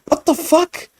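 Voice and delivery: a shocked high yelp of disbelief; reacting in disbelief to a scene in the show; incredulous. Real, not acted.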